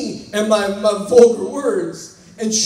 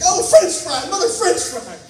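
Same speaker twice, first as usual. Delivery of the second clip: mock shout; 9 semitones higher than usual. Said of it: "I shout".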